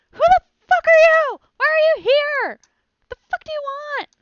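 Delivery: very high, very loud screaming demand; furious scream. Acted. Startled lady screaming at the stranger who broke in. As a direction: scream it at the intruder. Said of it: "she goes".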